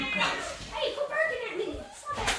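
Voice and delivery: very high mock scolding; squeaky scolding. Real, not acted. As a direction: squeak at the barking puppy. High-pitched scolding of a puppy.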